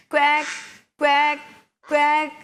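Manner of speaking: three loud separate quacks; duck quack. Shown as a demonstration. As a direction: imitate a duck for the children. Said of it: "താറാവ് എങ്ങനെയാണ്".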